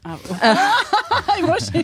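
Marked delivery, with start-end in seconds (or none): 0.4-1.8 high burst of laughter; laughing. Real, not acted. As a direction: crack up mid-sentence. (éclat de rire)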